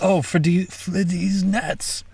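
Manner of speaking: slow, high, stretched punchline; milk the joke, draw it out high; drawn-out punchline. Pretend. Punchline delivered again, drawn out.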